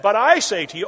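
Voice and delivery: loud, emphatic proclamation; Jesus proclaiming. Retold or imitated. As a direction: declare it with full authority. Preacher voicing Jesus' sermon.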